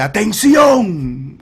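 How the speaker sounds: high, long-drawn call to attention; authoritative shout. Retold or imitated. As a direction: shout it like you command the whole street. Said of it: "grita con una voz de autoridad". (grito con voz de autoridad)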